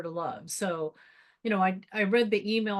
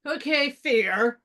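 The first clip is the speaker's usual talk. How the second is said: slow, rising reluctant okay; sheepish admission; 4 semitones higher, 3 dB louder, much slower than usual. Retold or imitated. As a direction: admit it grudgingly. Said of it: "I'm kinda going like".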